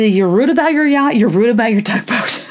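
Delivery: high, teasing mockery; mocking jab. Real, not acted. Host teasing the yacht owner, mocking. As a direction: poke fun at the boat owner.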